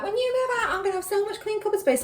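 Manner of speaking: mock her mother's smug glee at getting the cupboards back; a daughter doing her mum, voice pitched right up; mimicking mum. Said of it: "She's always like".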